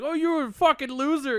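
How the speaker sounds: high, loud, slow taunting insult; 1 mock kid insult. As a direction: taunt the chatbot like a bratty kid. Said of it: "And you're like".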